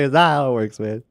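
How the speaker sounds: exasperated but laughing at the silliness; amused denial. Retold or imitated. Streamer repeating his reply to viewers, amused denial.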